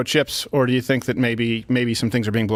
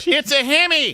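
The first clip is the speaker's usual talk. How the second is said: very high mock commercial catchphrase; high-pitched impression of an ad character; John Reep; 3 dB louder than usual. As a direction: yelp it like an excited truck-ad pitchman. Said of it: "John Reep just drives up".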